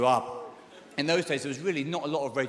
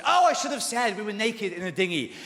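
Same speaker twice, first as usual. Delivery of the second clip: higher, louder mum outburst; mum's exclamation; 6 semitones higher, 4 dB louder than usual. Retold or imitated. Mum exclaiming over what she should have said, mock exclamation. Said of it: "my mum was".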